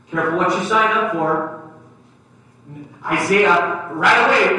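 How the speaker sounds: usual speaking voice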